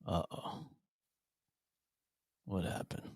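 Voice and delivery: mock-worried whisper, no voice at all; hushed whisper. Real, not acted. Host whispering to a grumpy co-host, hushed and worried. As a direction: breathe it out like something's wrong. Host reacts to co-host's face.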